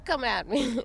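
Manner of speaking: old-timey cartoon brawler voice; tough-guy cartoon taunt; 1940s cartoon tough guy. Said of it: "nineteen forties cartoon".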